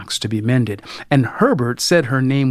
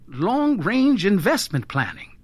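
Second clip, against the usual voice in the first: higher, vaguely offered answer; 7 semitones higher than usual. A narrator's reading. Husband putting off his wife's question, vaguely. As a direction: keep it airy and noncommittal. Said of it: "Herbert said vaguely".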